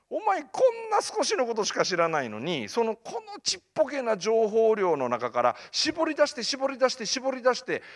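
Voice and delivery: high-pitched, insistent lecturing; emphatic scolding. Retold or imitated. Mentor to student squeezing out a dream, emphatic scolding. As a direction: hammer home the repeated words.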